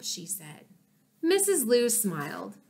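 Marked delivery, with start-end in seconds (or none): none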